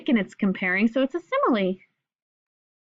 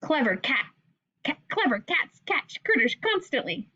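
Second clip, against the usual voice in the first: usual speaking voice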